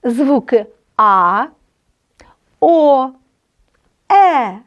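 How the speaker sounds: each vowel said slowly and clearly; vowel demo. Shown as a demonstration. Teacher modelling vowels for students, slow and clear. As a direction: model the sounds А, О, Е for the class. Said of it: "Подивімося уважно".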